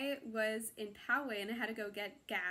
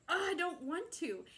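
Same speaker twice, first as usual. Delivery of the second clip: slow, higher half-joking groan of reluctance; mock reluctant whine; 6 semitones higher than usual. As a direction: drag it out like a kid who doesn't want to. Retold reluctance to the Spirit.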